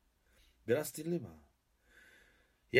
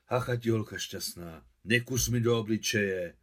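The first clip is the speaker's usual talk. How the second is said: annoyed; 8 dB louder than usual. A narrator's reading. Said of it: "rozčilila se stařena".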